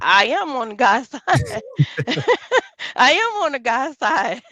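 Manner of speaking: high, loud, laughing insistence; laughing admission. Real, not acted. Caller gleefully admits siding with the guys, laughing. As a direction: proudly own up, breaking into laughter. Laughingly owning up to taking sides.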